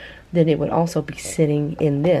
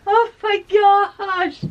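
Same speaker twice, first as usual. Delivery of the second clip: slow, drawn-out, higher amazement; amazed; 14 semitones higher, 4 dB louder, much slower than usual. Real, not acted. Marveling at her own dyed shirt, amazed and slow. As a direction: stretch it out in awe.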